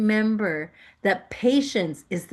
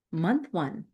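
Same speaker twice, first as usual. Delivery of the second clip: ordinary talk, nothing marked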